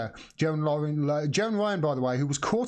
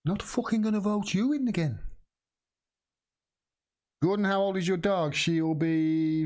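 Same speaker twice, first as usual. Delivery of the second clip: pitched-up, mock Scouse voter grumble; Liverpudlian accent; 6 semitones higher than usual. A Liverpool voter scorning his MP, Liverpudlian accent. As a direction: put on a Liverpool accent and jeer. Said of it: "gonna do a Liverpudlian accent then".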